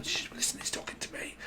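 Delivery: hushed unvoiced whisper; whispered claim he's talking to me. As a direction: hiss it secretly so others won't hear. Viewer hushing others, whispered.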